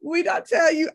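high, loud shriek, half-crying; reenacted scream. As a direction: relive the scream at the TV. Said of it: "I screamed at the T V".

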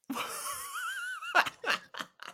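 a burst of squeaky, high laughter; laughing at the raccoon being chased with a bin; high squealing laugh. Real, not acted. Podcast host laughing at the raccoon story, high squeal.

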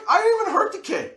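quick, loud, high protest; high-pitched protest. Retold or imitated. Telling how they defended themselves over the kid, high indignant protest.

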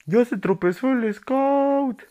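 grito burlón muy agudo y lento; burla. Retold or imitated. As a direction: imita a los que se burlan, bien alto. Imitando burlas de la gente. (burla aguda y fuerte imitada)

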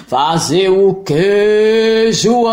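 monstro gigante, alto e arrastado; booming monster. Acted. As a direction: grita de cima, bem devagar. (monstro gritando)

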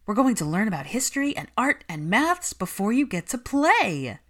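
put-on pep up a small child; animated. A narrator's reading. Nanny cheerfully coaxes the little boy, animated. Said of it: "sounding animated".

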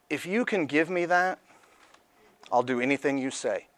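soft, hushed, pleading quote; desperate plea. Retold or imitated. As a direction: beg quietly as if nothing is left. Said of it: "And I was like".

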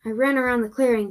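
ordinary delivery, nothing marked

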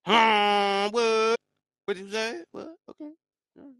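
loud, high, drawn-out "huh? what?"; exaggerated shouted questioning; mock disbelief. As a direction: holler it as if astonished. Mock disbelief at hiding aliens.

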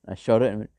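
normal talking voice

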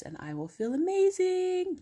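over-the-top, high-pitched triumph; mock euphoria. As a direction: sing out the imagined bliss. Imagined success after following steps.

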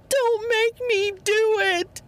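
very high, slow, loud mock plea; hammed-up pleading. Wife begs not to play the gamebook, high and slow. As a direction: beg in mock terror. She dreads the game book.

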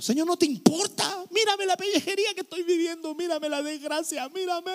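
a self-pitying believer begging for attention; whiny complaint. Acted. Preacher mocking a believer complaining to God, high whiny plea.

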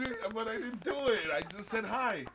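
high, loud mock denial; indignant protest. Host denying to friends he did a voice, indignant.

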